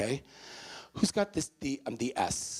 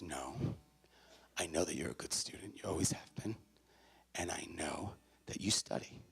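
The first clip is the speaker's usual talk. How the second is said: low, soft, measured reassurance; gentle sincere mother; 6 semitones lower, 8 dB quieter than usual. Retold or imitated. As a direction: lower your voice and level with your son. Mother gently answers her shouting son.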